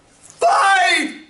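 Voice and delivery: very high, loud shout of triumph; hammed-up jackpot shout; excited shout. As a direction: yell it like you just hit the jackpot. Replaying ad's climax.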